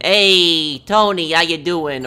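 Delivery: mock New York accent, Hey punched loud; a light New York guy greeting his buddy. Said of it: "Those are typical, like, New York accents".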